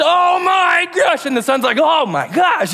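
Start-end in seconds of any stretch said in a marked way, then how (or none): 0.0-1.3 overjoyed shout. Acted. Father embraces son. 1.8-2.7 startled exclaim. Acted. Son reacts to hug.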